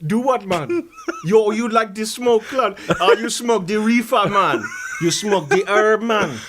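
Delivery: lively, lilting put-on Jamaican patois; hammed-up Jamaican accent with a laugh in it; fake Jamaican accent. Stoner offering herb to his host, fake Jamaican accent. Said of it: "this is not your real accent".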